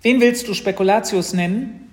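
high, loud little-sister question; nosy little sister. Acted. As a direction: butt in loudly as the curious sister. Sister surprises brother in doorway.

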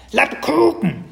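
very high, loud creature squawk; squeaky, hungry cry; baby dragon calling. A narrator's reading. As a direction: demand a treat like a tiny beast. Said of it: "rief das Drachenbaby Spekulatius".

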